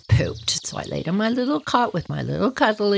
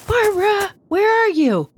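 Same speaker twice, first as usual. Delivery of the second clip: high, louder calling for Barbara; 13 semitones higher, 3 dB louder than usual. Retold or imitated. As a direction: call out for the missing kid. Parents calling for missing child.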